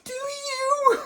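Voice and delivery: very high mock disbelief; high incredulous squeak. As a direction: squeak it in fake surprise. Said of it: "And I was like".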